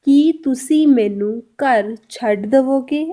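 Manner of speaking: very slow, careful question; slow Punjabi demo. Shown as a demonstration. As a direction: spell out each word for learners. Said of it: "Punjabi में कैसे बोलेंगे?". (बहुत धीमे-धीमे पंजाबी सवाल)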